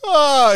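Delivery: high drawn-out "aah"; mock freak-out cry. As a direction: imitate a gleeful cry, way up high. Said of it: "Everybody's freaking out. He's just like".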